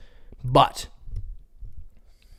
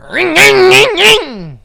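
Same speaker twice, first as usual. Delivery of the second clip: high loud engine revving; dirt bike engine noise; 10 dB louder, much slower than usual. Shown as a demonstration. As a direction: rev it hard like a dirt bike. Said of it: "Check out my Honda".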